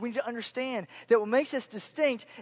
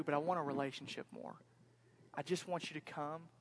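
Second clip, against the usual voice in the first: hushed, intimate and reassuring; tender God; 2 semitones lower, 5 dB quieter than usual. Retold or imitated. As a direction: speak gently as a loving God, with pauses. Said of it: "what he wants to say to us".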